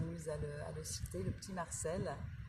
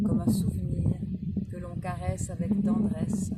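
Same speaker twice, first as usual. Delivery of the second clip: measured Proust reading, pausing between phrases; poised recital with spaced-out words; literary reading aloud; 7 dB louder than usual. A narrator's reading. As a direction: read it out phrase by phrase, savouring each. Reads a Proust extract aloud.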